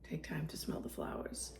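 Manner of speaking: dreamy hushed whisper. Real, not acted. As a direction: whisper it while smelling flowers. Smelling hydrangeas, dreamy aside.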